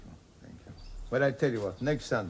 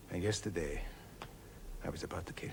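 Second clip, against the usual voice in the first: softer, whispered and grave; whispered confession; 7 semitones lower, 10 dB quieter than usual. Acted. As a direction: let the dark truth slip out. Confessing murderous thought.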